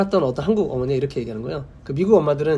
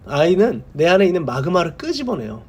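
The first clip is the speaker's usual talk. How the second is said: normal talking voice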